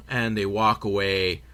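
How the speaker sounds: ordinary talk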